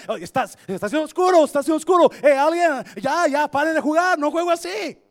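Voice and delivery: very loud, high desperate yelling; José yelling from the pit. Acted. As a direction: shout up from the pit begging brothers. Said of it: "gritaba José a gritos". (José gritando desesperado desde el pozo)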